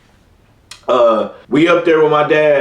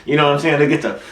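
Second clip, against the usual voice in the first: normal talking voice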